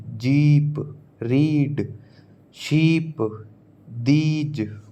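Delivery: जीप, रीड, शीप, दीज each with a held "ee"; long ee vowel. Shown as a demonstration. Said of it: "आपको इसका pronunciation आना चाहिए".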